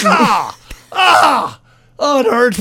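mock yelps of pain, pitch jumping up; hammed-up ouch while ripping hair. Acting out yanking armpit hair.

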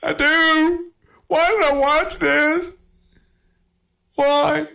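mock whiny cry. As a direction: whine it like a heartbroken kid. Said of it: "I'm like".

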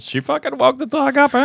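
high, squeaky mock movie impression; Will. Host playing Will chiming in on the fart story, high-pitched imitation. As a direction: squeal it high, breaking into laughter. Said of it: "when Will chimes in and says".

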